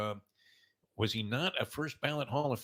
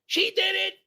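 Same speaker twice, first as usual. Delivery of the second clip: high, loud imitation of a screaming wife; 8 dB louder, much slower than usual. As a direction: mimic a wife yelling down from upstairs. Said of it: "My wife screams from upstairs".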